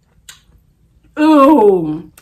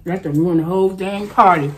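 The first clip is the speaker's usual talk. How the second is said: normal talking voice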